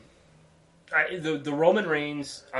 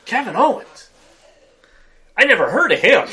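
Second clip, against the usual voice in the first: mock mechanic; 8 semitones higher, 9 dB louder than usual. Retelling the mechanic's reaction.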